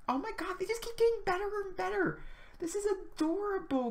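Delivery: delighted squeal. Real, not acted. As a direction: gush like you just found the cutest thing. Gushing over cute Sims items.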